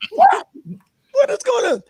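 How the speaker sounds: very high rising then falling cry of disbelief. Retold or imitated. Walking into the cabin, very high stunned question. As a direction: act stunned, pitch flying up.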